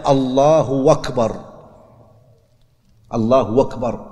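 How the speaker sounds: "أكبر" said as "wakbar", the glottal stop replaced by a w; "الله أكبر" linked with a w, "allahu wakbar"; hamza turned to waw. Shown as a demonstration. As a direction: glide from "الله" into "wakbar" with a w sound. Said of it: "لا يقلب الهمزة واوا".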